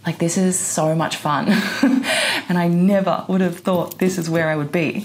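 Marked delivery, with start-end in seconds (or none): 1.5-2.4 quick high-pitched giggle; a delighted squeal of a laugh. Real, not acted. Amused at how fun her life is, high giggle.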